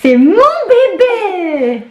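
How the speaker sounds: very high, slow cooing. Real, not acted. Mère roucoule au bébé. (voix aiguë et traînante de bébé)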